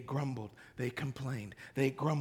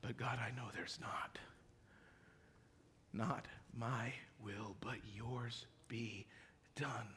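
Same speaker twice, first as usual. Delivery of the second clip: hushed surrender; 11 semitones lower, 22 dB quieter than usual. Acted. Preacher voicing Jesus yielding to the Father, whispered. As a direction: whisper the yielding like a broken man.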